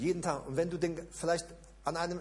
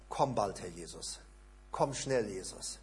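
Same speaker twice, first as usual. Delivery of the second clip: quiet plea, name dropped very soft; soft prayer; 8 semitones lower, 5 dB quieter than usual. Shown as a demonstration. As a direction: pray it gently and longingly. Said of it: "so anfängst zu beten".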